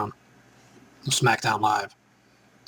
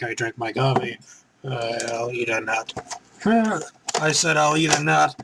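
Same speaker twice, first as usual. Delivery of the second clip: ordinary talk, nothing marked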